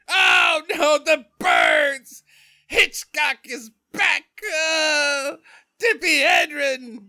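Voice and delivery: high, loud, slow hammed-up panic cry; Charlton Heston. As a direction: bellow it like an epic movie hero in distress. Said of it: "I'm now doing a Charlton Heston".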